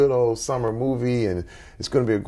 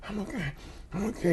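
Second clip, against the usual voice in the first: put-on higher, much softer little boy's reply; a hint of a boy gritting his teeth; son hiding his fear; 10 semitones higher, 8 dB quieter, much faster than usual. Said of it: "He's like".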